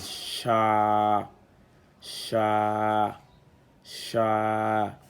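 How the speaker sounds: "sha" with the a held very long and level; lengthened A vowel. Shown as a demonstration. Teacher showing alif lengthens the vowel, long held "sha". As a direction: draw out "sha" each time, a long sustained aaa. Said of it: "l'outil d'allongement à la voyelle A".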